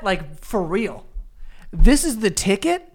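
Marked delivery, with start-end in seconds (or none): 1.7-2.9 louder, slow incredulous echo; mock his phrase in disbelief; incredulous laughing repeat. Real, not acted. Friend teasing his old-fashioned phrase, incredulous echo. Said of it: "What are you, Oliver Twist?".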